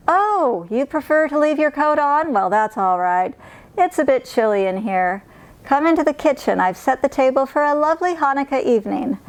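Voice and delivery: host a guest with bright, chatty welcome; kindly old woman. Acted. Narrator voices Baba Brena.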